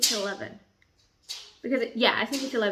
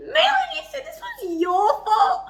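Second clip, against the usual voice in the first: high-pitched outburst; 13 semitones higher, 4 dB louder than usual. Real, not acted. Reacting to book twist.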